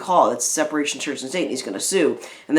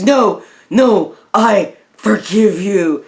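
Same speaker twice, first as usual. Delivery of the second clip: loud, higher, slow mock-heroic declaration; hammed-up climactic movie line, drawn out; dramatic forgiveness; 5 semitones higher, 6 dB louder, much slower than usual. Acted dramatic forgiveness from retold film.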